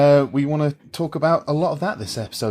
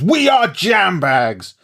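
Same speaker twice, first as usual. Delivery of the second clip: rallying cry; 7 semitones higher, 4 dB louder, much slower than usual. Acted. Mock rallying speech.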